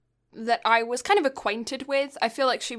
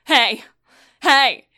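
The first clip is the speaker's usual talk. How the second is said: each hey louder, building to a called-out greeting; growing mock-provocative hey hey hey; insistent repeated hey; 9 dB louder, much slower than usual. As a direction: keep pushing the greeting, louder every time. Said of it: "Just be like".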